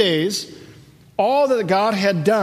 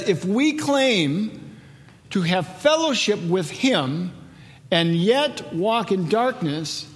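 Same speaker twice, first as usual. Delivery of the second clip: slow, measured reading of the verse; slow scripture reading; much slower than usual. Acted. Pastor reads the verse to the congregation, slow and measured. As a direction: let each phrase land with pauses between.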